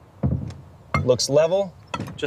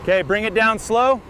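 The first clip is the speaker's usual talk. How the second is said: raised voice, higher pitch; calling out; 6 semitones higher than usual. Real, not acted. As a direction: call the directions across the site. Foreman guiding a lift.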